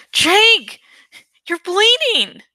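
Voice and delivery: high, loud alarmed cry; cried out. Acted. Cassie crying out to bleeding Jake, alarmed shout. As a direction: shout it in alarm at his wound. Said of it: "Cassie cried".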